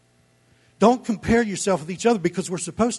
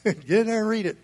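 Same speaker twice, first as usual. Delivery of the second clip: laughing repeat; 5 semitones higher, faster than usual. Shown as a demonstration. Pastor chuckling as he repeats his dare to the congregation. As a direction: toss it off with a laugh.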